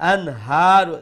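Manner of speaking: loud "Anharu" with a crisp, un-nasalised n before the h; izhar, clear nun. Shown as a demonstration. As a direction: say the n of "Anharu" cleanly, no hum. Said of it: "with izhar".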